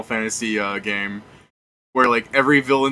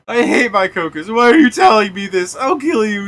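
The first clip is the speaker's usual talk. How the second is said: mock furious threat; 8 semitones higher, 5 dB louder than usual. Vega threatens the messenger.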